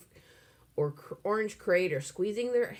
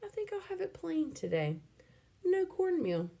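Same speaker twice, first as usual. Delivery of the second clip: put-on high kid voice; 13 semitones higher than usual. A narrator's reading. Alan voiced, smug kid.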